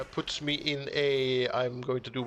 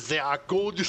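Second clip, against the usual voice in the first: mock German accent, a bit louder; 5 semitones higher, 4 dB louder than usual. Game builder joking about his build in a mock German accent. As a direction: playfully put on a German accent. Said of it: "I pretended to have a German accent".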